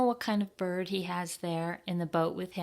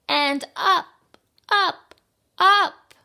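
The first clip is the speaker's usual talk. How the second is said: rising cheer; 13 semitones higher, 11 dB louder, slower than usual. Acted. Penguin finally takes off.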